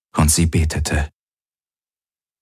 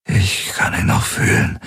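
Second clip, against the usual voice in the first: whispered, parched and unvoiced; dry, parched voice. Weary Galen tells Kirai and Hox, parched whisper. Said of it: "mit ausgetrockneter Stimme".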